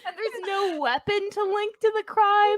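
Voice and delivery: high laughing mock drama. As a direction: cackle through the crime talk. Mock crime-drama narration, laughing.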